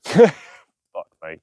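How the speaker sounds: sudden loud laughing delight; laughing exclamation. Real, not acted. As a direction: burst out delighted.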